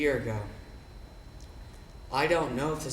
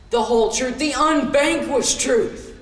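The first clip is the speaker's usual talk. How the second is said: emphatic declaration; 7 semitones higher, 8 dB louder than usual. Acted. Monologue narrator swearing to the truth, emphatic declaration. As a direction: proclaim it grandly, stretching the words.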